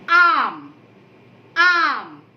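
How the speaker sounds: आम with a long loud आ; drawn-out aam. Shown as a demonstration. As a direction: stress the opening आ of आम. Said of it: "शुरू में हमने उसे उसके मूल रूप में". (आम का आ ज़ोर से खींचकर)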